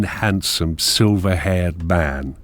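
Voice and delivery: ordinary talk, nothing marked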